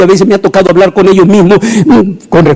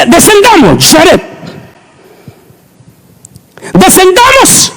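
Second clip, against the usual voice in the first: lightly voiced God addressing Jared; mock God voice; 13 semitones higher, slower than usual. Preacher joking, God calling Jared to descend. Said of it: "Y dice".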